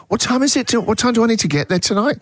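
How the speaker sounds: a flustered worried ask; mock anxious question. Imitating asking family the time.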